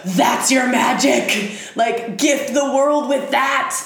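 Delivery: loud, high fervent plea; rally her like a believer; passionate urging. Acted. Pluto urging the artist to share her gift, loud. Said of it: "But he's like".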